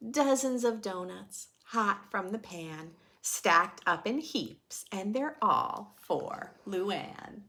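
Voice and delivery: put-on lively read-aloud for kids; children's story narration; storybook reading. A narrator's reading. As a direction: read the rhyme to a class. Reading picture book aloud.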